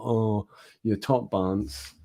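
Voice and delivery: lightly imitated Edinburgh accent. Showing viewers the Edinburgh sort of accent, lightly imitated. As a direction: try out some Edinburgh banter. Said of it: "that sort of accent".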